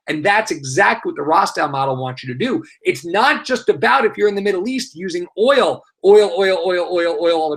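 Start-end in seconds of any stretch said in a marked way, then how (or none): 6.0-7.6 mock monotonous repetition. Teacher mocking reliance on one export, monotonous rattled-off repetition. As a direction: rattle it off like a stuck record.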